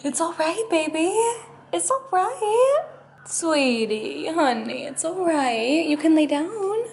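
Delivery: high sing-song coaxing for the dog to lie down. Real, not acted. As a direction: settle him gently.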